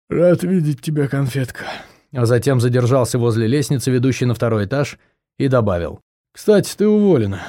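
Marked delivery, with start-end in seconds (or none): 0.1-1.6 drowsy mumbling man; sleepy mumble. A narrator's reading. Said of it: "и сонно пробормотал". 6.3-7.4 drowsy deadpan man; half-asleep offhand remark; sleepy mumble. A narrator's reading. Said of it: "и сонно пробормотал".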